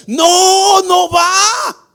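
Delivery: high, loud, breaking no; fervent cry. Real, not acted. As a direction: cry out the refusal. Preacher crying out refusal.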